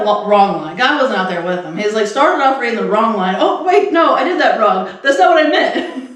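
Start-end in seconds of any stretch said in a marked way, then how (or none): 3.4-6.0 high-pitched mock fluster; imitated flustered reader. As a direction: act out correcting yourself in a tizzy. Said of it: "he's already flustered".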